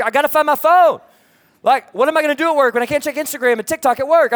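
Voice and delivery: high, loud, frantic complaint; hammed-up desperation, rushing through the middle; mock panic. Someone has lost their phone before work, mock panic.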